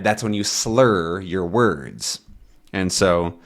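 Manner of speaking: normal talking voice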